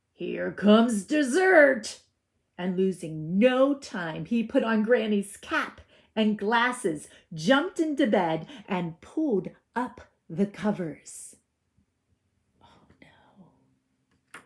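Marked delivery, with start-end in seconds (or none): 0.1-2.0 1 gloating wolf. Acted. 12.6-14.4 1 whisper. Real, not acted. Reader worried aside.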